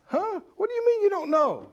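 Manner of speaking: a high, incredulous huh. Acted. Preacher to congregation, feigning high-pitched disbelief.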